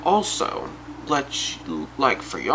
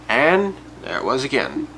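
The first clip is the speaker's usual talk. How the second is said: usual speaking voice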